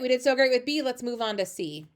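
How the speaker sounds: normal talking voice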